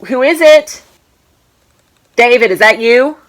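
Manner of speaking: loud, high call across the house. Real, not acted. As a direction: shout to find out who's there. Calling out to unseen knocker.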